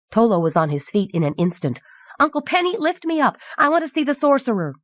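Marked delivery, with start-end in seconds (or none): 2.2-4.7 put-on high-pitched eager child's plea; excited little boy. A narrator's reading. Boy begging his uncle to lift him up, excited. Small boy begs to be lifted.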